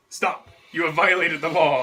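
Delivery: loud mock lawman's challenge; Skyrim guard. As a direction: bellow it like a video game guard making an arrest. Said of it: "like, the guards from Skyrim".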